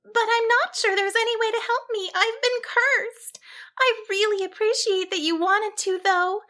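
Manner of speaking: high sheep voice, a touch of sorrow; a cursed sheep politely gives up hope; sorrowful. A narrator's reading. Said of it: "she concluded sorrowfully".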